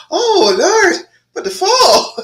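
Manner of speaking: high, loud, laughing mock shock; mock surprised gasp. Host voicing a lightheaded brain to viewers, high laughing surprise. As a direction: play the giddy brain flooded with oxygen. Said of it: "and it's like".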